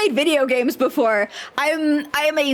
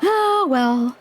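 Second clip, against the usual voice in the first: resigned; 4 semitones higher than usual. Real, not acted. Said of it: "Sucks to be me".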